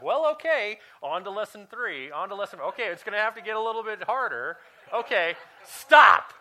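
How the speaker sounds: mock stern teacher, loud and high; God as teacher. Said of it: "He's like".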